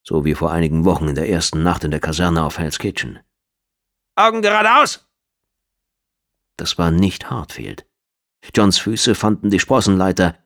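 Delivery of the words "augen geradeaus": high, loud barked command; drill sergeant shout. Acted. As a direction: bark it across the barracks.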